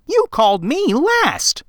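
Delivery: put-on high child voice, teasing reproach; a little boy scolding his great-grandpa; chiding boy. A narrator's reading. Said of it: "the boy chided".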